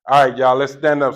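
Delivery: loud call to the whole room; rally everyone to their feet. Real, not acted. Speaker calling the crowd to stand, loud.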